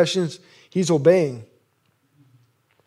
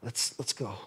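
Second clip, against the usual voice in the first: quiet, low urgent coaxing; hushed urgent whisper-like plea; hushed rescuer; 7 semitones lower, 16 dB quieter than usual. Retold or imitated. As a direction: coax him out softly. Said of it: "and he's like".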